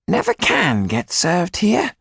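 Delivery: high, slow, peevish complaint; tetchy. A narrator's reading. Tetu complains of being ignored by the waiter, tetchy. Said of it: "said Tetu tetchily".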